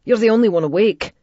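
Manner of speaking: put-on breathless boy's urgent plea. A narrator's reading. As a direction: rush it out urgently. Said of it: "Jamie said breathlessly".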